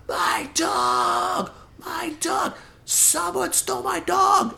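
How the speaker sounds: very high, strained wail of a frantic woman; imitating a woman wailing for her stolen dog; mock screaming lady. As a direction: shriek it like she's lost everything. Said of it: "screaming for her life".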